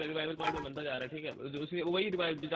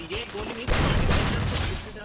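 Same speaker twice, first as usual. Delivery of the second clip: exasperated yell; 6 semitones higher, 9 dB louder, much slower than usual. Real, not acted. Complains about a missed shot.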